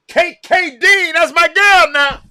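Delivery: high, loud, drawn-out cheer for her; excited cheer. Real, not acted. Streamer cheering the player on the broadcast, loud and high. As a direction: hype her up, stretching the name.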